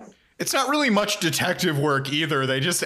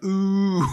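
high loud teasing ooh; 6 semitones higher, 4 dB louder than usual. Retold or imitated. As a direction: tease like a crowd. And it's just like.

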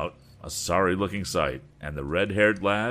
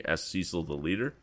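usual speaking voice

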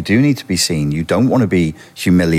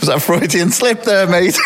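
laughing tease; 12 semitones higher than usual. Real, not acted. Teasing through laughter.

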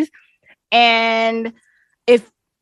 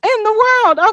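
high, loud laughing outcry; real shocked protest; 7 semitones higher, 7 dB louder, slower than usual. Real, not acted. Host mangles a Chinese greeting, loud shocked protest. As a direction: yelp it in disbelief. Reacts to host's mock accent.

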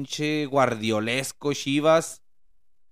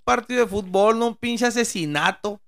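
fast, higher-pitched exasperated scolding; mock scolding; 8 semitones higher, 4 dB louder than usual. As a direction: rattle it off, half-joking reproach. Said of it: "le digo acá a mis compas". (regaño burlón y rápido)

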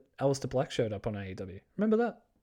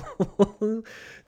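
a short, higher burst of laughter; 9 semitones higher, 5 dB louder than usual. Real, not acted. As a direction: laugh in disbelief. Laughing at Mox losing.

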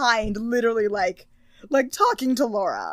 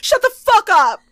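much higher, louder mock yell; 8 semitones higher, 9 dB louder than usual. Mocking Kristen screaming at Leela, shrieking loud. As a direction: scream it at Leela over the top.

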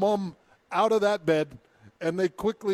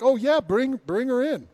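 higher, louder mock welcome; breezy welcomer; 8 semitones higher, 7 dB louder than usual. People at wrong house receive the patient, breezy mock welcome. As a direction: wave them in loudly and carelessly.

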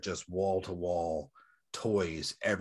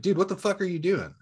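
high, sharp mock call-out at the scalper; a retold snap of outrage, pitched well up; retold confronting; 9 semitones higher, 3 dB louder than usual. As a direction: dude thrown out high in disbelief. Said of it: "looked at him and was like".

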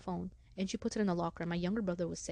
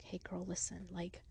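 hushed confidential murmur to the coach; confiding aside; 9 dB quieter, slower than usual. Retold or imitated. Student privately confiding in female coach, hushed.

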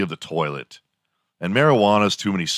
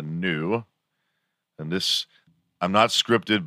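ordinary talk

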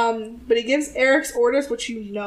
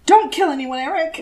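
mock nagging; 3 semitones higher, 4 dB louder than usual. Imitates Sookie's repeated scolding.

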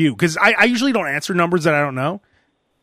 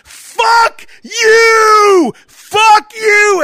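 screaming it high and loud, voice cracking; furious shout; 9 dB louder, much slower than usual. Retold or imitated. Telling off the scam caller, a screamed high curse. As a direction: yell it with a cry in the voice. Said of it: "And then I hung up the phone".

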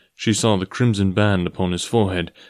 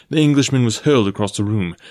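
ordinary delivery

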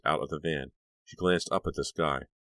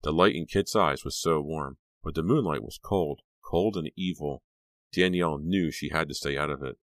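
ordinary delivery

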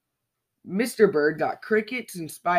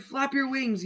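high urgent call, a little less shrill than hers; father's yell; 9 semitones higher than usual. A narrator's reading. Father bird urging his flying baby on, high yell. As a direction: call up to him to keep flapping. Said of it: "yelled Mister Bird".

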